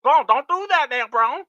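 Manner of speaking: high, loud Southern scolding; imitating Jamie Noble scolding Braun. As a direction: call Braun in a mock Southern drawl. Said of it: "He's like".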